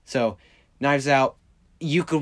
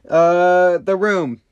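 excited announcement; 6 semitones higher, 9 dB louder, much slower than usual. Real, not acted. Exclaiming the movie title.

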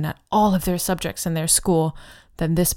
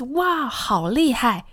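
excited compliment; 4 semitones higher than usual. Shown as a demonstration. Demonstrating a typical compliment.

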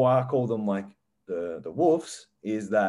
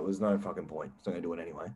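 defeated inner voice; 4 semitones lower, 7 dB quieter, faster than usual. Retold or imitated. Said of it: "it'll just be like".

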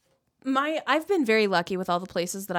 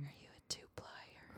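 barely audible whisper; mock-intimate whisper; 24 dB quieter than usual. Probing co-host's toilet paper choice, whispered. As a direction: whisper the question very softly.

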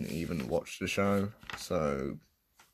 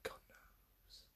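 whisper; 25 dB quieter than usual. Real, not acted. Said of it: "whispered aside".

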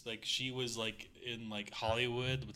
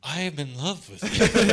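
lovestruck; 6 semitones higher, 11 dB louder than usual. Pretend. Lovestruck Harry voice, laughing.

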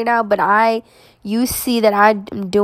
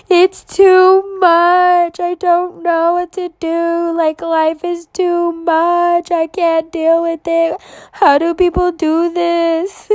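high, slow, strained voice near tears; replaying the overwhelm in a wobbly high voice; retold crying despair; 9 semitones higher, slower than usual. Telling her family how she broke down that day, in a high, slow tearful voice. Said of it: "I was like".

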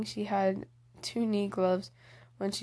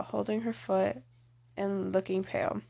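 ordinary delivery

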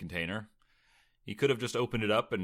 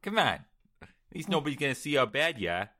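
put-on high, loud Boston accent; hammed-up Boston accent; 7 semitones higher, 4 dB louder than usual. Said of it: "Boston Tyler".

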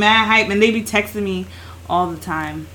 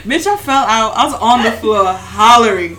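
normal talking voice

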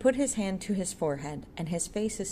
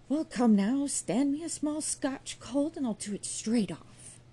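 higher, slow, swaggering bargain; bargaining boast; 9 semitones higher, 4 dB louder than usual. A narrator's reading. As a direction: strike a cocky deal for whisky. Jude bargaining for a whisky.

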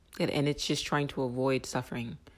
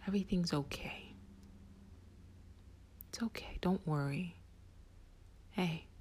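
soothing whisper; 4 semitones higher, 10 dB quieter than usual. Pretend. Soothing the panicked inner voice.

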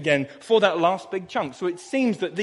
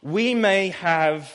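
very slow, weighted phrasing; slow emphatic reading; much slower than usual. Shown as a demonstration. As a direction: lean into each word slowly. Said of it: "look at that word".

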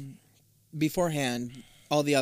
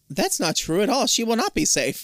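put-on old lady voice; 7 semitones higher than usual. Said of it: "Lady Violet is like".

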